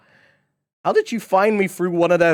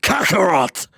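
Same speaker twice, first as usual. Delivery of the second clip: louder barked Kakarot; Vegeta voice; 4 semitones higher, 6 dB louder than usual. Acted. Voice actor mixing up characters, shouting Kakarot. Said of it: "confusing the characters".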